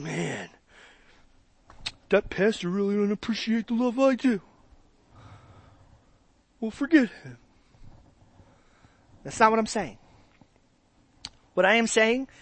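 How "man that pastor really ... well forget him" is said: muttered, resentful grumble; a sulky listener grumbling under breath; grumbling churchgoer. Retold or imitated. Offended churchgoer grumbling about the pastor. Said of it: "I don't want you leaving here today going".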